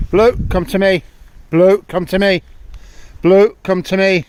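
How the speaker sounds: loud, high call across the field; repeated loud calls to the dog. Real, not acted. Calling a dog to come.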